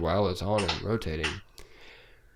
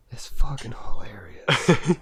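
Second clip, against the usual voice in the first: laughing whisper; 6 semitones higher, 3 dB louder, slower than usual. Real, not acted. Laughing whisper about chicken.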